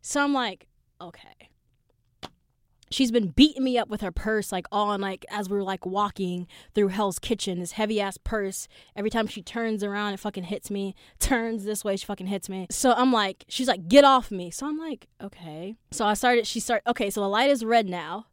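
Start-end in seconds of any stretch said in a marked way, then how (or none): 1.0-1.5 tiny, soft okay; meek. Retold or imitated. Meek response after being hit. 13.9-14.5 loud, snapped get off me; angry. Retold or imitated. Girl hits her again. 15.2-15.7 small, soft rising okay; timid acceptance under your breath; meek. Retold or imitated. Meek response.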